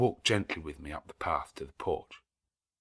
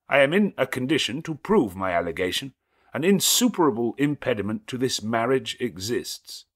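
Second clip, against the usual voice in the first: loud, higher, distinct declaration; a stranger halting the wedding; stranger's interruption; 5 semitones higher, 8 dB louder than usual. A narrator's reading. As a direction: cut the ceremony off firmly. Stranger voiced in novel.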